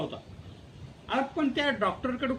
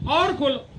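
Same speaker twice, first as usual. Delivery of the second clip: loud barked order to open wider; mock doctor's shout; 6 semitones higher, 9 dB louder, slower than usual. Dentist shouting at the patient to open his mouth wider, loud.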